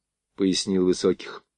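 ordinary delivery, nothing marked

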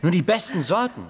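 proud boast. Acted. Boy brags to the gang, excited and proud. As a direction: show off the fine apples.

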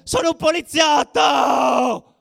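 high, very loud, slow stretched scream; desperate scream. Retold or imitated. Beaten undercover cop screaming his identity, drawn-out desperate yell. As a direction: scream it for your life. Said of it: "stava urlando".

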